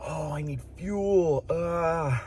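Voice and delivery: high, drawn-out groan; groaning complaint. Real, not acted. Vlogger realising fuel is needed, groaning.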